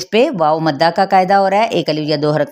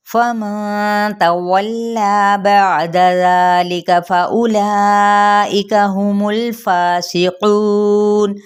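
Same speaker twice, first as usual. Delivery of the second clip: slow tajweed chant with long held vowels; Quranic recitation; 2 semitones higher, much slower than usual. Shown as a demonstration. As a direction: recite it ringing and sustained like tilawat. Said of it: "अब ये है फा पे hums करेंगे".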